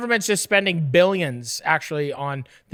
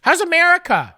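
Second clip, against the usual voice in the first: mock interviewer; 8 semitones higher, 7 dB louder than usual. Cohost asks in put-on voice.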